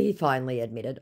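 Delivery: normal talking voice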